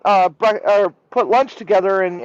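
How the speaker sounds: ordinary talk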